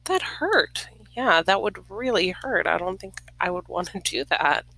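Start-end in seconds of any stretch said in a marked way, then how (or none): none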